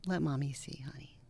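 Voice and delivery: whispered, tender coaxing; whispered mother's reassurance; soothing mother. Retold or imitated. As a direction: hush a hurt child gently. Mother soothing crying child.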